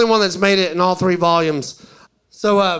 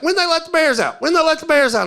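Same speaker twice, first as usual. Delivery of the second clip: high-pitched clueless tourist call; mock tourist's raised-pitch question; imitated tourist; 9 semitones higher than usual. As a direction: pipe up like an eager tourist. Mimics tourists repeating same question.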